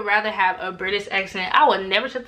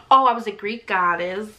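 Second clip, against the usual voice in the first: normal talking voice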